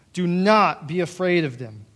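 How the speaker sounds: ordinary delivery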